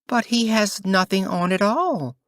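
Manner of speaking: put-on high, piping child's voice; little child. A narrator's reading. Little child in the crowd blurting out, high and innocent. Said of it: "said a little child at last".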